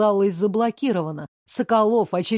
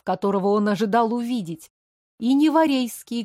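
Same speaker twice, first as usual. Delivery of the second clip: usual speaking voice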